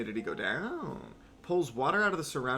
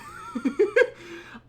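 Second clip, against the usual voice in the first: burst of laughter; 8 dB louder than usual. Real, not acted. Laughing at own impression.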